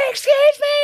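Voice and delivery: high loud mock shout of excuse me; mock yell. Runner chasing her down the street, mock loud shout. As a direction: call it out high and loud, hammed up.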